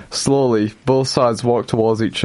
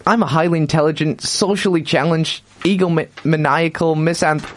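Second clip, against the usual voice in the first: quick, higher nerdy boast; young Sheldon voice; 4 semitones higher than usual. Acted. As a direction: rattle it off like a smug genius. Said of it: "said young Sheldon".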